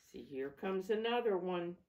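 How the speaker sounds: ordinary delivery, nothing marked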